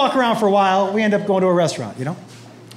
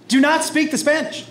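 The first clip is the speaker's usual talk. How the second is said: raised-pitch dad scolding; exasperated order; 6 semitones higher than usual. Retold or imitated. Dad reminding son not to speak Spanish, exasperated order. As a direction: repeat the ban, pitched up in exasperation.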